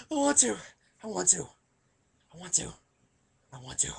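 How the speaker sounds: hammed-up crying trailing off into a whimper; fading sob. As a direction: let the wail sink to nothing. Repeats fading off.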